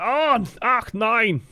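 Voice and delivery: high, hammed-up German lament; comical panicked Reinhardt. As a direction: cry out like a cartoon German in dismay. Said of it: "going steadily more comical".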